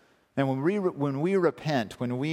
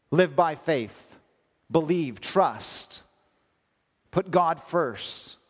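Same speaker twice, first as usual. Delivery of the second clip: slow exhortation; much slower than usual. Acted. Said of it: "are saying".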